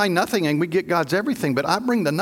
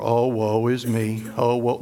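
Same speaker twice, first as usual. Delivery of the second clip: Eeyore moping; 8 semitones lower than usual. Retold or imitated. Pastor imitating Eeyore's gloomy woe. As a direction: sigh it out like a gloomy donkey. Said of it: "we think of Eeyore".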